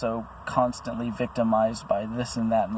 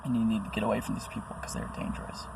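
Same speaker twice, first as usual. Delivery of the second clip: whisper; 8 dB quieter, faster than usual. Real, not acted. Warning viewers to get away.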